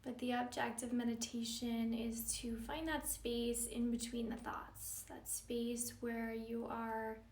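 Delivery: calm, measured yoga-teacher meditation delivery; guided meditation. Acted. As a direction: guide them gently into stillness, leaving space between phrases.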